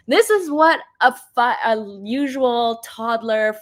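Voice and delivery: ordinary talk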